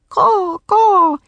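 loud, high cawing; raven call. A narrator's reading. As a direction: caw out thanks like a bird. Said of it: "he called as if thanking her".

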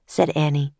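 usual speaking voice

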